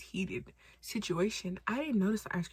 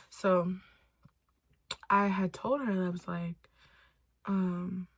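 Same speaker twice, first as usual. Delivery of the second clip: ordinary talk, nothing marked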